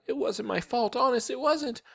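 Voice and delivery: put-on high, pleading little-girl voice; crying out. A narrator's reading. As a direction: protest innocence in a high whine. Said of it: "Sheila cried".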